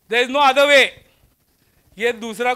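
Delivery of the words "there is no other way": emphatic declaration. Real, not acted. Lecturer hammering home key point.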